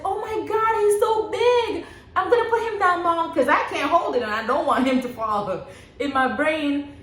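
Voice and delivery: high, excited doctor voice; imitating the surprised doctor; amazed doctor. Doctor lifts the baby for mother, high and amazed.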